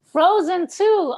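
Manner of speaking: high, loud, drawn-out delighted reply; delighted echo. Real, not acted. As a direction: echo it with big enthusiasm. Delighted echo of child's answer.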